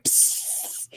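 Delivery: unvoiced spray noise; spray hiss. Spraying bitch-be-gone spray.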